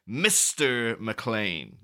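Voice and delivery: very slow, drawn-out put-down; condescending. Retold or imitated. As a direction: drag it out, looking down at him. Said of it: "said in a very condescending way".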